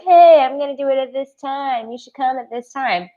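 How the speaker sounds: bright mock-cheery heads-up voice; mock announcement. Teasing viewers with a mock cheery announcement. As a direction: playact a chirpy scheduler. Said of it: "giving you a heads up and saying".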